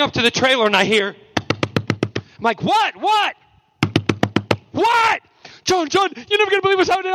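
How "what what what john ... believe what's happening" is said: high, fast, breathless gushing; hammed-up excited chatter, much higher; mock giddy excitement. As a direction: burst in bursting with news. Imitating David excited about script.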